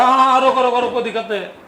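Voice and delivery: loud, high conductor's call; bus conductor calling. Acted. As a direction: shout it like a conductor hopping off.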